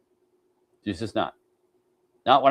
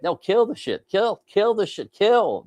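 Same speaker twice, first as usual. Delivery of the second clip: higher, slower, pressing on kill; mock man's insistence; 9 semitones higher, slower than usual. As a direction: hammer home fix it now, hammed-up. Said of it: "we're like".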